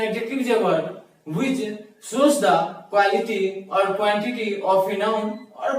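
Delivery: very slow, word by word; slow definition read; slow definition dictation. Shown as a demonstration. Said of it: "one word में कह सकते हैं".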